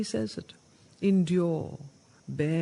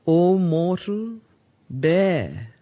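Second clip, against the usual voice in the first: slow, louder call to the mortal; solemn declamation; 7 dB louder, much slower than usual. Acted. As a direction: proclaim it as a commandment. Narad's call read again.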